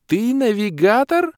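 high, loud, slow squealed question; drawn-out incredulous shriek; stunned disbelief. A narrator's reading. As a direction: squeal it in astonishment. Kira stunned by the revelation.

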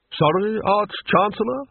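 high, rising feigned puzzlement; innocent. Acted. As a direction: play innocent to your superior. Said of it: "his voice oozing innocence".

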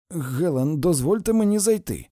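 loud, high-pitched appeal; urgent appeal. A narrator's reading. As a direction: call through the door to be let in. Man appeals at locked door. (наполегливе прохання)

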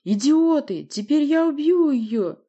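loud, slow furious threat; angry threat. Acted. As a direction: shout the threat slowly. Villain threatens hostage.

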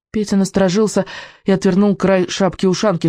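ordinary delivery

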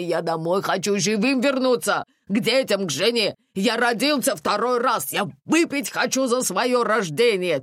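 excitable driver in passionate protest; exasperated driver Ashot. A narrator's reading. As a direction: argue emphatically, stretching key words.

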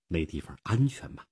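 ordinary delivery

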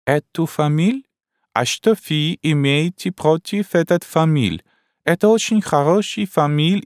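put-on high, slow, broken-Russian old Frenchwoman; a bewildered elderly French wife, high and slow; old Frenchwoman puzzled by her husband; old French lady. Old French lady's lines.

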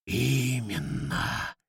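a dark power speaking through a hen. Acted.

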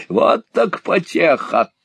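high, loud and slow jeer; stretched-out, high-pitched mock delight; mocking glee. A narrator's reading. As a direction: crow it like a gloating villainess. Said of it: "захохотала миссис Сквирс".

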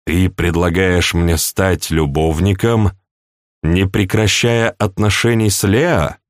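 slow, deliberate question; slowly. Acted. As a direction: spell it out slowly, checking he understood. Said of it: "медленно сказал Сергей". (Сергей медленно переспрашивает принцессу)

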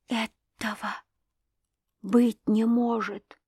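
hushed, halting disbelief; barely audible whisper. A narrator's reading. Wife stunned by the accusation, barely audible whisper. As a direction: whisper it slowly, with long breaks. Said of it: "прошептала она едва слышным голосом".

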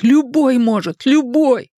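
very high, drawn-out panicked cry; terrified cry. A narrator's reading. Richie's mind panicking over death, high terrified cry. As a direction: let horror push the voice right up. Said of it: "в диком ужасе закричал его рассудок".

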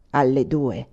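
low basso profondo, dropped well down; deep bass voice. A narrator's reading. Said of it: "rispose una voce di basso profondo".

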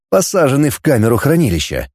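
usual speaking voice

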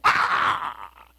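usual speaking voice

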